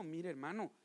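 soft, slow humble confession; admitting fault to a brother, soft and slow; humble apology. Acted. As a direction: own up to it quietly and slowly. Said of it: "¿qué tiene que hacer uno?".

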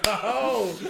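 a loud, high, startled "Oh."; shocked oh. Retold or imitated. Fan recalls reacting to the rival fan's insult, loud startled oh. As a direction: blurt it out in mock disbelief. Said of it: "I was like".

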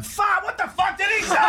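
very high, squealing mock outrage; outraged bus passenger. Bus passenger overhearing Hindi swearing, high-pitched mock shock. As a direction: shriek it in disbelief through laughter. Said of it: "Someone is on the bus going".

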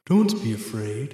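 lightly voiced mysterious voice out of nowhere; disembodied voice. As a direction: speak as an unseen presence, only lightly put on. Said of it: "you hear this voice once again".

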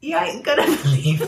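hammed-up British, barely holding it together; horrible British accent. Shown as a demonstration. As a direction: do a bad British accent through giggles. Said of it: "in my horrible British accent".

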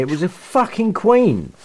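usual speaking voice

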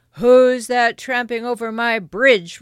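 very loud, angry troll challenge; troll's roar. Acted. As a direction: bellow at the trespasser as a troll. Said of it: "roared the troll".

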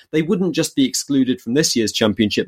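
ordinary talk

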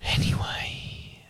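drawn-out, very soft whisper. Real, not acted. Reader returning to the story after Dad's interruption, slow whisper. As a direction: whisper it slowly to move on.

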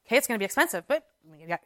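normal talking voice

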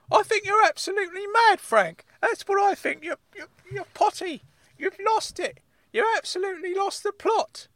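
high-pitched ranting uncle; Uncle Claude, worked up. Acted. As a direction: berate him in a high comic voice. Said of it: "why are you getting so worked up about it".

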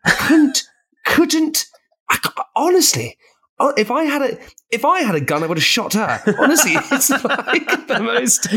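high-pitched, laughing outrage, words breaking off; comic exasperation. Real, not acted. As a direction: splutter in exasperated disbelief. Ranting about fiancée talking through film.

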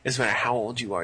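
ordinary delivery

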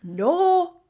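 loud, high, sharp refusal; sudden firm no. Retold or imitated. Barmaid refusing the barred regular, loud and sharp. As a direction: slam the door on him. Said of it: "this voice comes out of me".

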